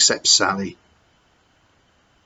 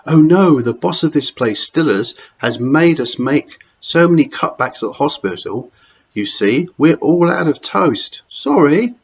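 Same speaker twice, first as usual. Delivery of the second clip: a lightly higher, sing-song dinner lady; chatty and apologetic, voice lifted a little; kindly dinner lady; 5 semitones higher, 4 dB louder than usual. Acted. Dinner lady telling the boy there is no toast, apologetic.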